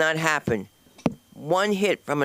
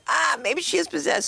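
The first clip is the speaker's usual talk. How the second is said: mock nuns; 10 semitones higher than usual. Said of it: "they'd be like".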